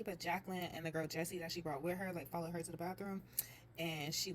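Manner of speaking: ordinary talk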